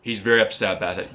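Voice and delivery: ordinary talk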